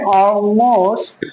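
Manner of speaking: Almost as a long "aw-most", L hidden; drawn-out Almost with rounded first vowel; dark L demo. Shown as a demonstration. Teacher models it for learners, dark L demo. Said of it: "बल्कि हम क्या बोलेंगे".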